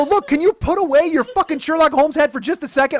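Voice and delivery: loud, high, irritated plea; exasperated rant. Retold or imitated. Boyfriend fed up with his girlfriend's detective questions, exasperated. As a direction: snap it loudly in frustration. Angry plea in retold quarrel.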